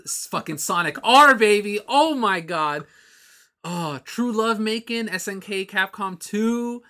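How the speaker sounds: loud, excited gushing; hyped up. Real, not acted. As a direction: geek out loudly. Hyped over game classics.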